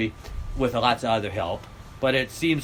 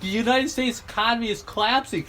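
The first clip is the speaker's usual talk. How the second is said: mock gleeful Gates; 8 semitones higher than usual. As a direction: imitate someone thrilled by disaster. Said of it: "It's like going".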